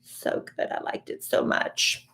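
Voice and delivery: breathless whisper after crying; tearful hushed whisper; choked-up whisper. Real, not acted. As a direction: barely voice it, moved to tears. Moved to tears by the show.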